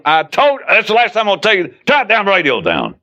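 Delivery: high-pitched, exasperated telling-off; irritated scolding. Acted. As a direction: scold as if it's the final warning. Acted scolding over a noisy radio.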